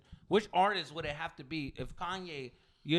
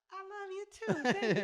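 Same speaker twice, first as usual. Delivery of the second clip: [name]'s reply. Retold or imitated. Comic retells [name] answering a fan, imitated high and slow. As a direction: answer sweetly in a high little voice. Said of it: "And he goes like".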